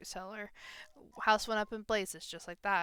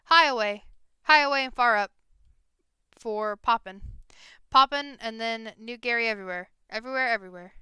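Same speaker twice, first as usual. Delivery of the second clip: goblin voice; 9 dB louder than usual. Acted. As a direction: greet them like an eager little goblin. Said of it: "the goblin shouts".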